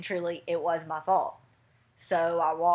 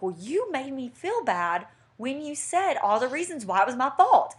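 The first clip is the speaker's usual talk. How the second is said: high-pitched quarrelling retort, louder at the end; yelling accusation; 7 semitones higher, 4 dB louder than usual. Retold or imitated. Woman to fiance arguing in the car, yelling accusation. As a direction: act out the argument, building to an indignant finish. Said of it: "we're, like, yelling at each other".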